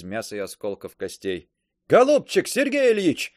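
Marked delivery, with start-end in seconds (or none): 1.9-3.3 calling out. A narrator's reading. Wounded officer calls out.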